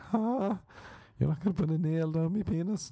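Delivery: very high, incredulous questioning; mock disbelief. Patient to American doctor offering a cup, high mock disbelief. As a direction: squeak up high in put-on surprise.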